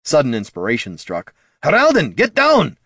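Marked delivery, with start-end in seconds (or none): 1.6-2.8 high, loud warning cry; urgent shout. A narrator's reading. Dwarf warning the bard in his path, shouted. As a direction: yell it as an urgent command.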